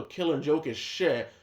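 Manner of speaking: usual speaking voice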